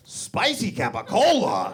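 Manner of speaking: put-on high, slow, ears-perked old man; intrigued old man. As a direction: savour each word with sudden interest. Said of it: "he goes".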